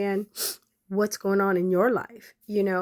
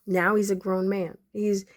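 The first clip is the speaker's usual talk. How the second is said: ordinary delivery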